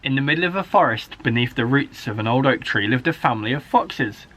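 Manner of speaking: ordinary delivery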